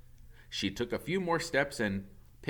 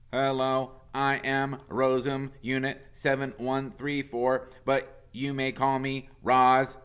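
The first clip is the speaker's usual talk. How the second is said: a hint of a robot reciting its greeting, higher and louder; automatic robot voice; 6 semitones higher, 7 dB louder than usual. A narrator's reading. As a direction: read it like a machine switching on by default. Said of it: "the robot said automatically".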